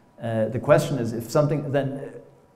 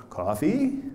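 "Coffee," asked with the pitch rising at the end; questioning rise; 6 semitones higher than usual. Shown as a demonstration. Lecturer demos "Coffee," to students as a rising question. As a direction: say "Coffee," as a question, tune going up. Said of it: "it's a question and that there's a rising pitch".

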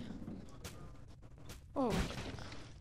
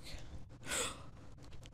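sharp scared gasp; 13 dB quieter than usual. Real, not acted. Scared in horror game.

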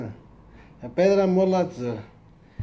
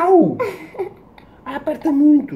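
pained yelp; 11 semitones higher than usual. Real, not acted. Clothespin pinches dad's ear.

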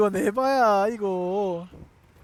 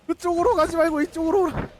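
calling out loudly; 8 semitones higher, 5 dB louder than usual. Real, not acted. Calling monster to come.

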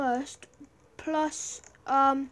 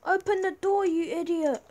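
higher, louder impatient yell; annoyed yell; 4 semitones higher, 4 dB louder than usual. Real, not acted. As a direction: shout at the guard to open up. Yelling at guard.

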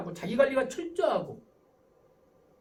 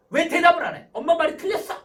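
high, loud mock telling-off; exasperated parent with raised voice; scolding mother; 7 semitones higher, 11 dB louder than usual. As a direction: act a mother challenging her child angrily. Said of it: "엄마가 자녀를 혼내킬 때 이렇게 혼내킵니다".

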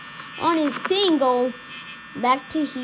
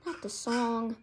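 softer, lower defeated repeat; resigned mutter; 3 semitones lower, 9 dB quieter than usual. Real, not acted. Player accepting doom from the song, quiet resigned. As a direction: give up as you say it.

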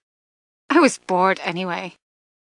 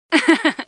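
teasing laugh; 9 semitones higher than usual. Acted. Short taunting laugh.

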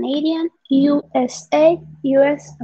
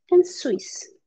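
whispered; 5 semitones higher, 4 dB quieter than usual. Acted. Whispered word.

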